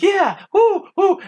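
high, loud whoop; Billy cheering. Retold or imitated. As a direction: whoop it like a kid showing off. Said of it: "Billy's like".

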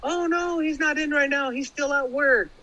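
high, bright sing-song phone greeting; really cheerful. Retold or imitated. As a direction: chirp it like a cheerful wife answering the phone. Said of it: "really cheerful".

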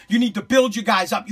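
ordinary talk, nothing marked

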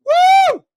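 long high shouted woo; Ric Flair woo. Acted. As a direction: let out a wrestling-fan woo at full volume. Célébration de lutte.

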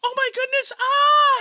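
high, loud mock shriek of alarm; hammed-up panic. Imitating the actress panicking over Whit's leg. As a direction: overplay the panic for laughs. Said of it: "made me laugh a little bit".

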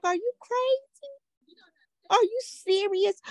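high, slow incredulous questions; retold disbelief. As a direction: stretch it out high, in exaggerated disbelief. Retold disbelief at daughter's excuse.

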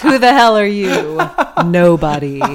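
loud laughing boast; cocky kid dancer. Pretend. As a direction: brag like a bratty little dancer.

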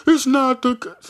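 sarcastic whiny imitation; mocking quote. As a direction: parody what everybody says. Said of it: "Everybody wanna say".

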